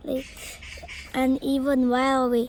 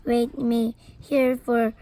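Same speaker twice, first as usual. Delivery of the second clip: usual speaking voice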